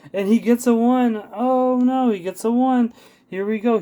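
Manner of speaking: high, loud excited call. Acted. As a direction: call the near-fall with alarm. Dramatic near-fall in match.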